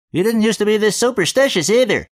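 high cartoon voice, loud; comedy voice. A narrator's reading. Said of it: "A comedy voice".

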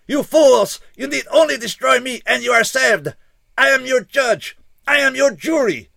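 high, loud, pleading outburst; desperate shouting alien. A narrator's reading. Alien shouts at the fools to destroy him, high and loud. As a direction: hurl each line at them as a command.

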